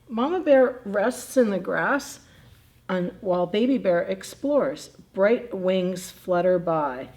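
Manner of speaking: धीमी, साफ़, उत्साहभरी कहानी वाली आवाज़; बच्चों को किताब पढ़कर सुनाना, धीरे-धीरे; reading to children. A narrator's reading. Reading picture book aloud to kids.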